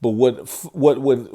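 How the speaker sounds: ordinary talk, nothing marked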